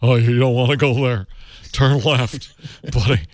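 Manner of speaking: hint of an [name] croon; [name] impression.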